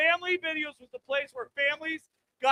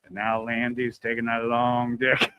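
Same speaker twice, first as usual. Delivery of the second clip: much lower, slow narrator voice; deep movie narrator; 12 semitones lower, slower than usual. Retold or imitated. Comic imitating Morgan Freeman narrating, deep and slow. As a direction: drop into a deep trailer voice. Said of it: "He just, uh, goes over".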